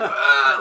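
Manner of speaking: a loud, hammed-up cry of pain, laughing through it; mock pained yelp. Clark recoils from Lex's green rock with a loud mock yelp. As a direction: yelp like the rock just burned you.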